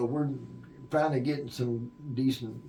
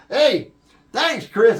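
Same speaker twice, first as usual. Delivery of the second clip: high, loud mocking call; passerby calling out; 12 semitones higher, 12 dB louder, much slower than usual. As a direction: shout the thanks as you walk past. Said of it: "somebody walking behind ... says".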